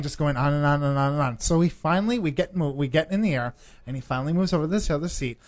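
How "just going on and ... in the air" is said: ordinary talk, nothing marked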